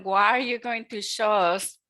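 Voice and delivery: ordinary talk, nothing marked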